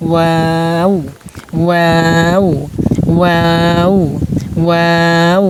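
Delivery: ওয়াও stretched, rising at the end; drawn-out chanted ওয়াও from w through aa to oo; rounded, opened, rounded. Shown as a demonstration. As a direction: round the lips, open on the long a of ওয়াও, then round again. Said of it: "প্রথমে একটু বেশি গুডুল করে".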